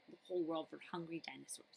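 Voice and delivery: quick, soft whispered aside. A narrator's reading. Narrator whispering a sly aside to listeners. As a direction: let the secret slip in a fast whisper.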